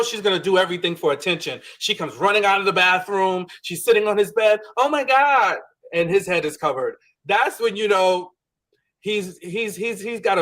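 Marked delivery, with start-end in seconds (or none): 4.8-5.6 mock gasp. Imitating Huda's dramatic reaction.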